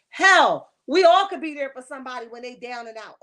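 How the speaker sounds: sudden loud outburst; loud rant. Real, not acted. Host loudly rants back at the housewife's excuse. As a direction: blast it back at her, fed up.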